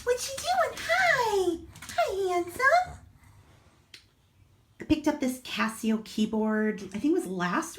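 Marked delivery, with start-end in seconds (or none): 0.0-3.0 much higher sing-song voice for the dog; pet-talk to the dog. Real, not acted. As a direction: owner greeting her dog Max in a high doggy voice. Still cooing at the dog.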